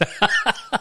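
voiceless airy laugh; breathy laugh. Real, not acted. Laughs at own voice.

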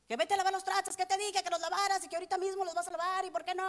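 high, fast scolding nag; scolding mother. Retold or imitated. Mother nagging her kids to wash the dishes, high scolding. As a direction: bark out chores like a nagging mom.

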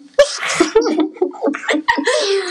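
risa alta y aguda; carcajada aguda. Real, not acted. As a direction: suelta la carcajada. Risa en charla de pódcast. (carcajada aguda)

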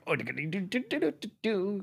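bouncy sung-out do-do-do swooping high; imitated Pokémon cry. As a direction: mimic a jiggling cactus cry. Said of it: "'Cause it's going like".

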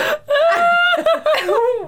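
high screaming laugh burst; shrieking laugh. Real, not acted. Armpit reveal.

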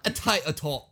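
Liverpool-sounding English patter; English accent. Shown as a demonstration. Stalling a romance speech, Liverpool-sounding English accent. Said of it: "you sound like you're from Liverpool".

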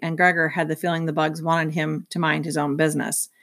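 usual speaking voice

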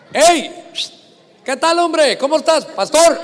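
loud, high, teasing shout across the stadium; cheeky stadium shout at the pastor; shouting from the stands. Retold or imitated. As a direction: yell it down to him from up top. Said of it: "Ay, gritándole desde arriba".

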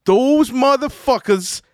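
high, slow mock yell in a New York accent; angry New Yorker. Angry fan yelling in a parking lot, New York accent. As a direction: imitate an irate New Yorker, stretching every word. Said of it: "this is why he's a New Yorker".

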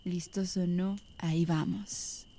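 slow spooky whisper. Pretend. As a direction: tease the audience in a hushed voice. Starting scary stories by fire.

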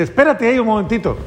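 Martín holding him. Retold or imitated. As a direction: toss it off lightly as if asking him to wait a moment. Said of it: "le dice".

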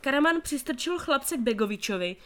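usual speaking voice

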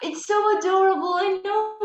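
high, delighted squeal of agreement; gushing. Real, not acted. Co-host cooing over a dad's tweets, gushing. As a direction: gush in a high, loud voice.

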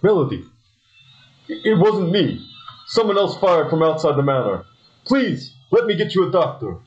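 high, frantic pleading; panicked. Acted. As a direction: voice rising in panic over her. Husband finds his shot lover.

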